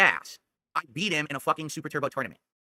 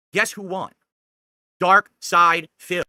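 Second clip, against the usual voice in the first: self-hype; 2 semitones higher, 7 dB louder, much slower than usual. Acted. Boasting who won.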